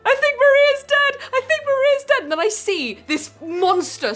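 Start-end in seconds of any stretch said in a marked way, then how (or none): 0.0-2.2 high, loud alarm to a partner; frantic high-pitched re-enacted shout; retold panicked shouting. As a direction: yell the bad news in a squeal of panic. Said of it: "I was, like, shouting".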